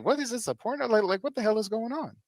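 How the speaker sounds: high, startled disbelief; shocked disbelief. Retold or imitated. Mover reacting to a landlord at the door in a towel, startled disbelief. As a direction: react like you walked into a porno scene. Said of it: "I'm like".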